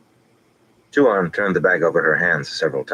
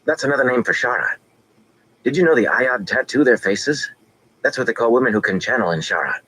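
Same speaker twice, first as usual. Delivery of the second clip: lightly voiced boy, high and keen; a boy showing off what he learned; eager boy; 8 semitones higher than usual. A narrator's reading. Boy Oliver voiced in story.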